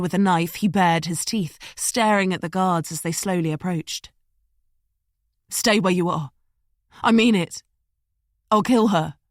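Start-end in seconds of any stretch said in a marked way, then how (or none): 5.5-9.2 loud, cornered threat; desperate threat. Acted. Liam threatens the approaching guards, loud and desperate. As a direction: warn them off with a knife.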